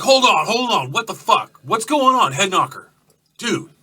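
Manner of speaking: loud, high, urgent biker holding them back; alarmed biker shout. Acted. Gus shouting to halt the bikers, loud and high. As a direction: cry out to stop the knife fight. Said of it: "Gus is like".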